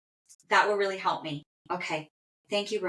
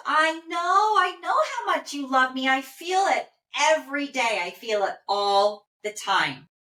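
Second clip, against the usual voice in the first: gushing reply; 6 semitones higher, 4 dB louder than usual. Retold or imitated. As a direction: relay it with heartfelt emphasis, starting high. Voices the loved one's gushing reply.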